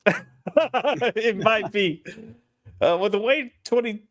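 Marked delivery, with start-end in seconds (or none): 0.1-2.0 slow laugh-through words. Real, not acted. Laughing at joke.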